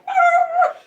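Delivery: loud, very high dog howl imitation; imitated excited dog noise. Dog answering the vet who found him, imitated high loud yowl. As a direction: let out a dog's excited yelp. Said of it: "And he's like".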